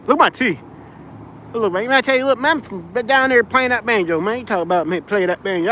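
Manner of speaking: lightly high, playful Southern twang; mock country accent. Answering a viewer's jab, playful put-on country twang. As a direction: tease the banjo joke in a put-on twang.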